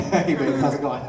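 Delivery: suave, easy greeting to the wife; smooth Lupin voice. Retold or imitated. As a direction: lay on the charm, easygoing. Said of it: "talking a little bit more smooth".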